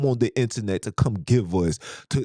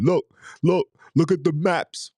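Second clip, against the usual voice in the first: higher mocking voice of a know-it-all; mocking the Eurocentric guy; 10 semitones higher than usual. Mimicking a brother who pushes European maps, higher. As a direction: parrot him, higher and preachy.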